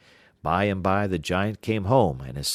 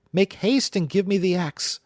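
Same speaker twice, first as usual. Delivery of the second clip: much higher pleading shout; urgent cry; 12 semitones higher, 4 dB louder than usual. Acted. Jack urges his mother to bring the axe. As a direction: hurry her with panic. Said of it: "cried Jack".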